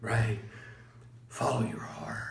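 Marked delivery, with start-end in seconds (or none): 1.3-2.3 dreamy whispered cliché; mock whisper. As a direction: whisper the slogan mockingly. Mocking internet slogan.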